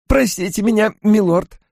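high-pitched, breathless innkeeper; breathless apology. A narrator's reading. Cuale begs his lord's pardon, breathless. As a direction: apologise as if barely able to breathe. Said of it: "едва дыша промолвил Куале".

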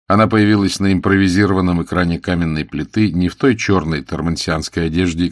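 ordinary delivery, nothing marked

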